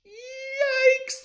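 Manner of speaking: very high, loud yelp; frightened cry; startled yelp. Acted. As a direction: shriek in fright. Startled by window.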